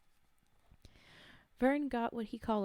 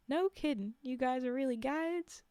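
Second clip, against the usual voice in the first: put-on Alabama accent; 4 semitones higher than usual. American hunter asking the guides, in Alabama accent. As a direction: play the tourist in a lightly voiced Alabama accent. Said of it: "He's from Alabama".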